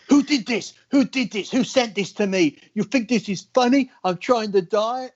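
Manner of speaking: high, furious yelling; imitating a furious rant; angry outburst. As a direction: rage at the prank like a starving bodybuilder. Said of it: "nasa lost his shit".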